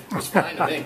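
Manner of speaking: ordinary delivery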